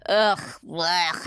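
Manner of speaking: mock retching "blech"; disgusted groan. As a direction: groan in exaggerated disgust, as if gagging. Acting out old post-set disgust.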